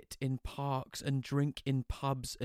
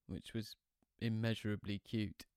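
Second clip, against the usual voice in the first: ordinary delivery, nothing marked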